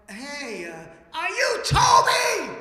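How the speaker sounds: high, loud shout across a distance; calling out. Retold or imitated. Jack at his gate shouting to ask the stranger, high loud call. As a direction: yell it slowly down the road.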